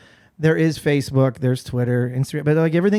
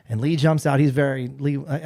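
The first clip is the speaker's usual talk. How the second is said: ordinary talk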